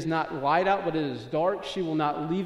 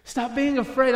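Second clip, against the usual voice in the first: higher, louder preaching exhortation; forceful command; 9 semitones higher, 8 dB louder than usual. Acted. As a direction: drive it out in one urgent run. God's words repeated with force.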